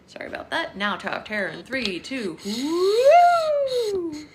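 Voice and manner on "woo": much higher, much louder whoop; excited whoop. Real, not acted. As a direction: let it fly on the drop. Ride game with baby.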